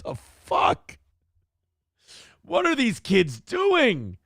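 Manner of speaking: mock horror at the texts; shocked disbelief. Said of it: "You're like".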